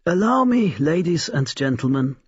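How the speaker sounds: high, showman's flourish; old toymaker announcing to the guests; grand announcement. A narrator's reading. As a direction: present it like a ringmaster. Old man announces his creation.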